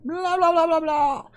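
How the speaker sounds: high mock NPC gibberish; NPC chatter. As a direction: mimic a game character's filler talk. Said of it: "a simple N P C talking".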